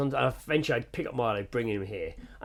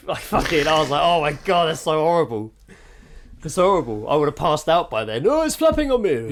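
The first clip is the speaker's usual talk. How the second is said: louder, rising horror; horrified; 3 semitones higher, 7 dB louder than usual. Retold or imitated. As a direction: build up appalled disgust. Retelling gory bath scene.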